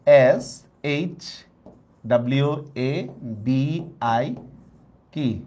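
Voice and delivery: letters of বিশ্ববিদ্যালয় spelled slowly, unsure; slow letter spelling. Shown as a demonstration. Teacher slowly spelling a long word in English letters. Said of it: "কিভাবে লিখবো".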